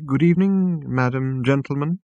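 prim, high, disapproving maitre d'; snooty waiter voice. Acted. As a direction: greet them like a sniffy head waiter. Disapproving green blur waiter greets.

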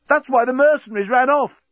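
high, loud eager squeak; excited corporal. A narrator's reading. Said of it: "exclaimed Inchby Wiglet enthusiastically".

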